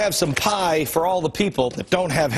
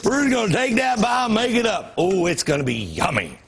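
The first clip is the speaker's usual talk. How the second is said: higher, cheery clueless chef voice; mock cheerful government; 8 semitones higher than usual. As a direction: play a naive cook proudly. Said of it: "They're just like".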